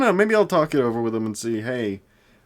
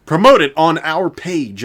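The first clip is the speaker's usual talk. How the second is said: mock spam bot; 6 semitones higher, 10 dB louder than usual. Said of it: "the exact same response of".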